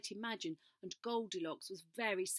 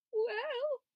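whispering Small Bear; 8 dB louder than usual. A narrator's reading. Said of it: "whispered Small Bear".

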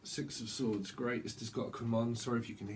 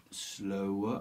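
normal talking voice

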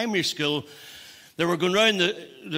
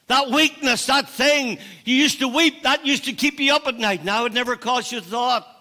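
higher, urgent preacher's voice; fervent preaching; 8 semitones higher, 6 dB louder than usual. Real, not acted. Preacher mourning lost sorrow over sin, fervent. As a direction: press each phrase on the congregation.

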